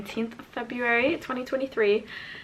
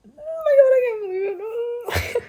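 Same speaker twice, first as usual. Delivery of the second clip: happy tears; 13 semitones higher, 5 dB louder than usual. Real, not acted. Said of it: "crying a little bit".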